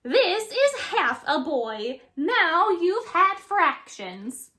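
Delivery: high, loud, slow smug lesson; smirking. A narrator's reading. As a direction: drag out the punchline smugly. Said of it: "she smirks".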